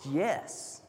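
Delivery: soft, low reassuring voice; a tender hushed promise; gentle Jesus. Acted. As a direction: reassure them quietly.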